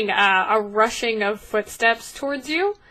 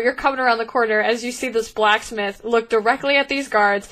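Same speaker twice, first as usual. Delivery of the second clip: ordinary delivery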